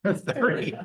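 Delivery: amused, laughing repeat of the number; laughing echo. Real, not acted. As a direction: laugh it out in surprise. Echoing Rich's thirty years, laughing.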